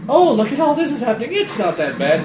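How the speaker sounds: high, fast, breezy and clueless; oblivious cheerful voice. Retold or imitated. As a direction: play it dopey and carefree. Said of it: "it's just like".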